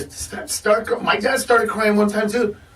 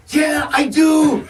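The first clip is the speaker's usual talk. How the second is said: slow shouted retort; 6 semitones higher, 3 dB louder, much slower than usual. Real, not acted. Retorts to TV doctor.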